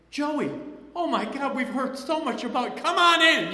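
high, loud, delighted welcome; enthusiastic father. Retold or imitated. Father throws open the door for his daughter's date, overjoyed. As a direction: gush out the greeting like a thrilled dad. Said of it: "he was so enthusiastic".

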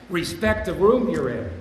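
a loud order to respect the room; firm warning. Retold or imitated. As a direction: drive the warning home to the newcomers. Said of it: "I tell these guys".

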